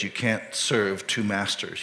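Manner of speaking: ordinary delivery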